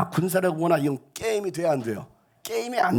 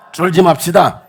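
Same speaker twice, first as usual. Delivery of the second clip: much louder rallying cry; loud exhortation; 2 semitones higher, 13 dB louder than usual. Real, not acted. Pastor urging the congregation, loud rally. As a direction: rouse the crowd.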